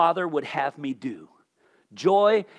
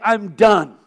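fed-up outburst; 4 semitones higher, 9 dB louder than usual. Retold or imitated. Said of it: "He's just like".

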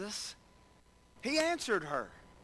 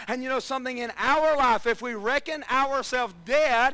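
preaching; 3 semitones higher, 5 dB louder than usual. Acted. Preaching builds again.

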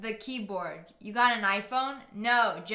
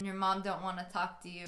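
usual speaking voice

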